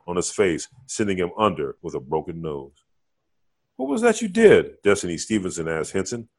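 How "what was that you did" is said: high, loud astonished question; amazed woman. Acted. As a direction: voice her wide-eyed. Woman amazed after fight.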